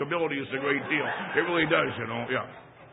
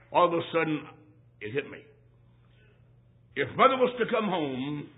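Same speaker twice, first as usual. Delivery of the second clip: ordinary talk, nothing marked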